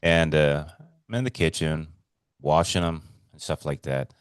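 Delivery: ordinary delivery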